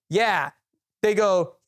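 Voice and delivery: usual speaking voice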